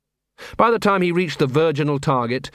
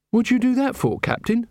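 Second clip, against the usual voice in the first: high-pitched, questioning character voice; puzzled young subordinate; 10 semitones higher than usual. A narrator's reading. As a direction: ask it high, as Carrot the subordinate. Carrot questions the captain, puzzled.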